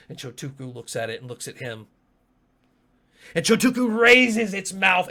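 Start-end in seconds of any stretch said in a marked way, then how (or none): 3.3-5.1 loud, high climactic narration; dramatic shout. A narrator's reading. Game master narrates Chotuku's final move, dramatic shout. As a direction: roar the narration at full force.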